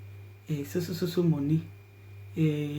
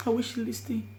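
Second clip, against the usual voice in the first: crying; 17 dB quieter than usual. Real, not acted. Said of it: "I'm sorry, guys".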